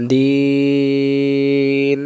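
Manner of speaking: দিন with the vowel held very long; three-alif stretch. Shown as a demonstration. Teacher demonstrating the long vowel in দিন. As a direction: draw out দিন over three beats. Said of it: "এই তিনটা যেখানে সেখানে তিন আলিফ".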